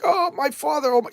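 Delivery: high-pitched hammed-up lament; retold daughter's cry of dismay; mock anguish. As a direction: wail it lightly in imitation. Daughter sees her father die.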